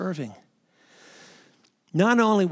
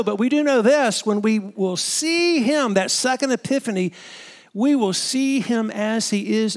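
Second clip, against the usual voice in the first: preaching at full force; 3 semitones higher, 4 dB louder than usual. Acted. Preacher rouses pastors about seeing Jesus, full force. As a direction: build it to a rousing climax.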